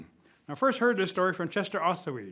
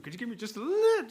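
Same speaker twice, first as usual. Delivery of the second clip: higher, pleading squeeze on little; wheedling plea; 7 semitones higher than usual. Acted. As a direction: coax them for a tiny bit. Rabbit begging for food.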